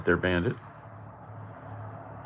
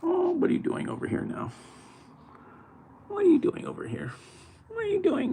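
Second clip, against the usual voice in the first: very high, soft pet-talk voice; sing-song high voice for the kitty; 4 dB quieter, faster than usual. Real, not acted. Talks to cat in pet voice.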